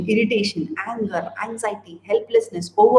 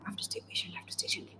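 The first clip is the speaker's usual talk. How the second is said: whispered mantra; 17 dB quieter, much faster than usual. Pretend. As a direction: whisper the mantra under your breath, voiceless. Quoted internet mantra, whispered.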